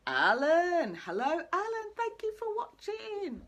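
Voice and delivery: loud name call, then high sing-song thanks; sing-song greeting. Real, not acted. Host spots Alan watching the live stream, sing-song greeting. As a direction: greet a friend in a high, drawn-out chant.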